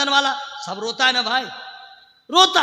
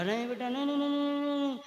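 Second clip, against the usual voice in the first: much softer, gentle mother refusing; mother soothing; 8 dB quieter, faster than usual. Retold or imitated. A mother gently refusing her child the ice cream, soft. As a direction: coax the child softly. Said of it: "माँ ने कहा".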